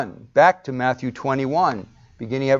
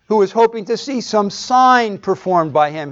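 usual speaking voice

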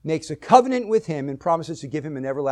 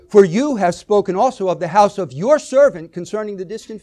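"you" and "your" punched louder; stress on YOU and YOUR; 3 semitones higher, 7 dB louder than usual. Shown as a demonstration. Preacher reading David's prayer aloud, "you" and "your" stressed. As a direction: hit "you" and "your" hard. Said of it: "put emphasis on certain words".

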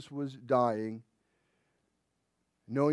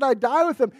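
boastful vow; 12 semitones higher, 11 dB louder than usual. Retold or imitated. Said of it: "he said".

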